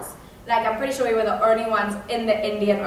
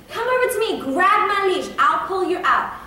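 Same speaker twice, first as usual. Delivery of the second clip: high-pitched acted urgency; urgent instructions; 6 semitones higher than usual. Brother offering sister his leash, acted urgent calling. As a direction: play a brother frantically coaxing her over.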